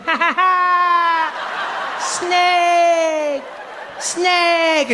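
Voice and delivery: put-on very high, drawn-out jeer in a French accent; mocking French kid. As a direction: cackle and taunt like a French child. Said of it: "And this kid's like".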